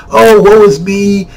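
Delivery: hammed-up woe-is-me complaint; mock self-pity. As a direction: play the poor victim, slowly. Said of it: "People wanna cry".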